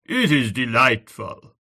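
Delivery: slow, earnest and convinced; a lightly voiced Frenchman praising the evening; with conviction. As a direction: savour each word as if you mean it. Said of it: "said with conviction".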